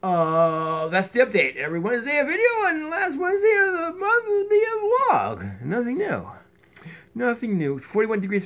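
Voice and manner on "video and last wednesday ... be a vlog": squeaky voice. Pretend. High strained voice.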